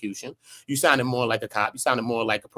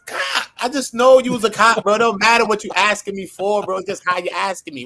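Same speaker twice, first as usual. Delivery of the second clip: raised, heated street voice; street guy; 7 semitones higher than usual. Retold or imitated. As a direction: imitate a guy on the street calling you a cop. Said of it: "Somebody might come up to say".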